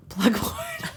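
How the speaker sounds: "plug horn" with a laugh breaking in. Real, not acted. Cohost misreads Slughorn, laughing at herself. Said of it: "laughing over misread name".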